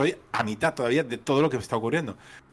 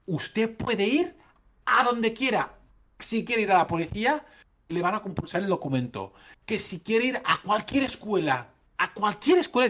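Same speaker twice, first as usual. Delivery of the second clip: tono prepotente; 7 semitones higher than usual. Retold or imitated. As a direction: spell it out like a smug clerk to a fool. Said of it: "me lo decía con un tono potente". (funcionaria condescendiente imitada, mucho más aguda y lenta)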